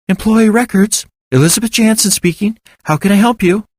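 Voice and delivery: put-on a young woman answering her office phone; female receptionist. A narrator's reading.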